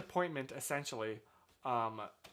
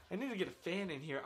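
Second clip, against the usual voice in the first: ordinary talk, nothing marked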